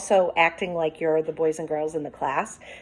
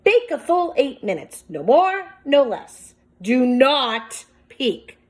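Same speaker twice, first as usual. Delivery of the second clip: higher, louder, commanding read; stern recipe instructions; 6 semitones higher, 7 dB louder, slower than usual. Acted. Teacher voicing the recipe's warning to the class, higher and commanding. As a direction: lay down the rules, slow on do not peek. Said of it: "the recipe read".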